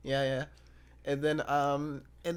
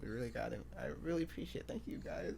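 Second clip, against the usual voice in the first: normal talking voice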